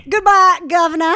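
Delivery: put-on "Goodbye, Governor" in a deliberately awful British accent; bad British accent. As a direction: ham up "Goodbye" like a cartoon Brit. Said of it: "you should hear a British accent. It's rubbish".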